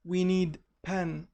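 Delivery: slow, deliberate wrong version "We need pen"; wrong example. Shown as a demonstration. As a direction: spell out the mistake slowly. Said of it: "هنا غلط".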